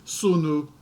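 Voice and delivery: put-on SUNU as Old English, "SOO-noo"; Old English pronunciation. Shown as a demonstration. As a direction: say SUNU in two syllables with oo vowels. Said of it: "you will recognize father".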